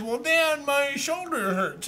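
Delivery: put-on high, whimpering little boy; mock-blubbering plea to dad; blubbering child. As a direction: whine it like a hurt kid near tears. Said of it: "and I'm blubbering".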